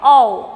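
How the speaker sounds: "औ" held as a clear open vowel, dropped low; sounding out the vowel. Shown as a demonstration. Teacher modelling the vowel sound for students, clear and low. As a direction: model the sound "औ" for children to copy. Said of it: "इसका उच्चारण होता है".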